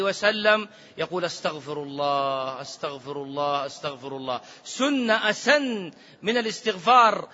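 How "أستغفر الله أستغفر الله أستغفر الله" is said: soft istighfar. Shown as a demonstration. Demonstrating the Prophet's istighfar.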